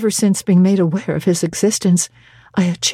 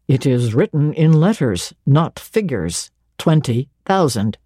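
lower, dry teasing clergyman's voice; St. John Rivers, low; 6 semitones lower than usual. A narrator's reading. Stern clergyman teasing Jane, in a lower voice. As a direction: play a reserved man gently mocking, lower.

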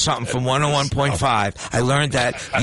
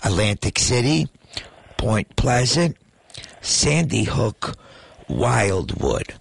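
slow, drawn-out trivia question with pauses; slow game-show question read; slow quiz read; much slower than usual. Acted. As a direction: stretch out each option like a quiz host. Reads trivia question with options.

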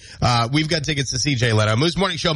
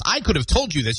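ordinary delivery

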